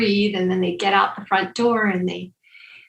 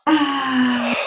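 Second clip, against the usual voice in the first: audible exhale sigh; 4 semitones higher than usual. Acted. Exhale during twist exercise.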